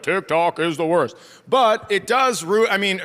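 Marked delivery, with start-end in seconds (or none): 0.0-1.2 lightly voiced old grouch; oldest person alive. Old person complaining about TikTok, mock elderly. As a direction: grumble like someone ancient. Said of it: "sound like the oldest person that's ever lived".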